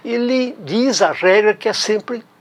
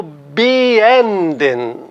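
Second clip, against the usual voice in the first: beenden with a strong, long EN in the middle; stress on middle syllable. Shown as a demonstration. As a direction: separate be, then hit EN in beenden. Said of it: "Olha onde eu coloco a sílaba tônica".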